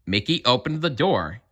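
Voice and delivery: ordinary delivery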